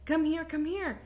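mock urgent child's call; imitated calling out. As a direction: call dad over urgently. Retold child calling dad.

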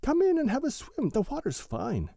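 high, eager little muskrat; a bouncy muskrat luring a friend in; Jerry Muskrat voice. A narrator's reading. Jerry Muskrat invites a swim.

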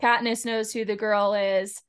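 ordinary talk

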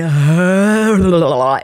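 loud helpless laugh; burst of laughter. Real, not acted. Laughing at the hot pepper.

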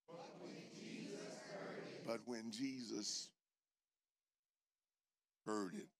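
congregation reading aloud together; many voices in unison. Real, not acted. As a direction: read it together, everyone at once. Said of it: "let's read it aloud together".

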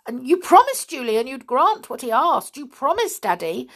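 urgently. A narrator's reading. Jenny begging her father to keep his promise, urgent. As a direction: press him hard, don't let him off. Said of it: "said Jenny urgently".

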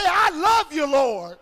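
big loud cry of devotion; hollered praise. Shown as a demonstration. Preacher having church repeat it without music, hollering loudly. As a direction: holler it high, then drop on the last word. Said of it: "somebody holler".

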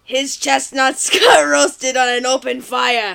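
low mock Austrian action-hero accent; Arnold impression. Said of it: "I had to get into it".

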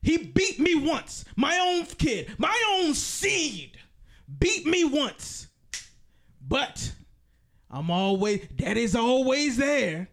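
hammed-up Pacino impression. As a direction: channel Pacino's devil preaching to his son. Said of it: "I love this Al Pacino performance".

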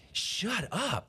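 high-pitched mock disbelief; imitated amazed customer. Retelling a car commercial customer, mock amazement. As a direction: exclaim it like an astonished car buyer. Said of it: "And the customer was saying".

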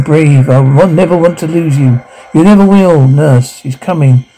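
ordinary talk, nothing marked

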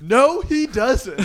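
lightly voiced mom setting him straight; mock mom correcting. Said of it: "And she goes".

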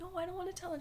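high, small reluctant voice; shy protest. Retold or imitated. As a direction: imitate a shy kid backing out. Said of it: "I was shy".

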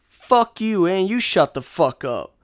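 telling the heckler off, heated; angry retort. Real, not acted. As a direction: snap it back at him hard. Firing back at the heckler.

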